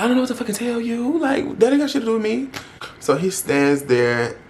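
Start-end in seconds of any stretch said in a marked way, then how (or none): 0.0-2.5 high, fast, acted-out exasperation; exasperated retort. Rejecting his complaint, retold in high-pitched exasperation. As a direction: fire it off in a high-pitched rush. Heightened retake of her retort.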